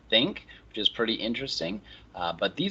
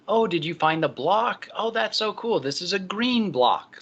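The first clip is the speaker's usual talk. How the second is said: high-pitched, exaggerated talk to a toddler; child-directed speech; 10 semitones higher than usual. Shown as a demonstration. Lecturer showing how adults talk to infants, exaggerated child-directed speech. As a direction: coo over the toy in a much higher, singsong pitch. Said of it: "talk in a higher pitch and, uh, at a slower tempo with more exaggeration".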